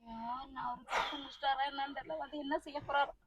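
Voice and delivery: low, very soft whimpering; tearful lament. Acted. Mother fearing what father will do, tearfully despairing. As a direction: fret helplessly, close to tears.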